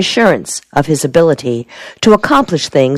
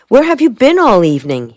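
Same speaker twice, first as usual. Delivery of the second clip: higher-pitched pressing question; demanding; 6 semitones higher than usual. A narrator's reading. Soldier questions Helen about her evening, demanding. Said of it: "he demanded".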